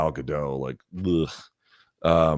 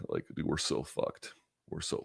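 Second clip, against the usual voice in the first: whispered; 2 semitones lower, 12 dB quieter than usual. Real, not acted. Despairing aside to the audience.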